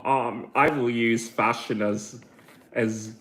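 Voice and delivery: ordinary talk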